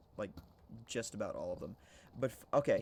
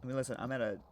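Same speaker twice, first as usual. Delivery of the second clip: ordinary talk, nothing marked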